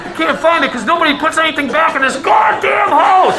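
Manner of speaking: loud, cursing father yelling downstairs; angry father. Retold or imitated. Dad hunting his wrench on Christmas Eve, loud cursing. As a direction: bellow at the whole house.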